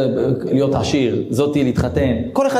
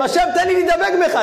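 fervent plea; 14 semitones higher than usual. Acted. Voicing a plea to God.

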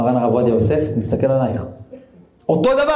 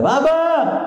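put-on a little girl calling Daddy, much higher; child's excited call; much slower than usual. Little daughter greeting her father at the door, high child's call. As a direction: cry out Abba like a small child running to him.

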